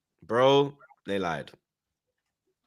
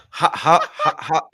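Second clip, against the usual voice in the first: starstruck stammer, pitched up with a laugh; stuttering; 5 semitones higher, 4 dB louder, slower than usual. Retold or imitated. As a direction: trip over the word how, flustered. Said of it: "I started stuttering like".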